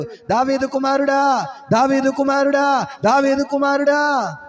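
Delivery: high, drawn-out calls to a passerby; beggar crying out. Retold or imitated. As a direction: call out for help, pleading. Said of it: "ఒక బిచ్చగాడు కేకలు వేస్తున్నాడు".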